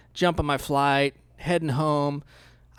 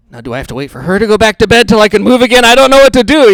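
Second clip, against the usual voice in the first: fast, loud, rising mock panic; frantic thoughts, rushing and loud; panicked inner voice; 5 semitones higher, 16 dB louder, faster than usual. Frozen in the hallway fearing the wife, mock panic. Stuck mid-step, panicking.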